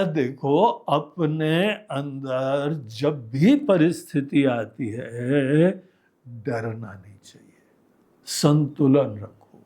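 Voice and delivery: slow, low, measured counsel; retold soothing advice, unhurried and low; calm reassurance. Retold calming advice to frightened companions.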